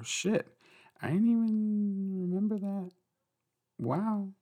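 high, squeaky disbelief; amazed at herself, squealing; surprised. Real, not acted. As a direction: jump up in pitch, amazed. Surprised by reading count.

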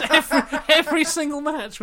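high-pitched, through laughter; laughing. Real, not acted. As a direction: tell it while cracking up, pitch up. Laughing at friend's running joke.

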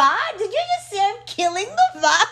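mock shocked, half laughing; incredulous. Answering God's teasing, laughing and incredulous. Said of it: "And I was like".

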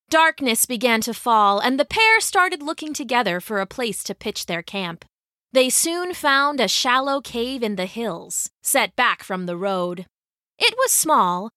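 ordinary delivery, nothing marked

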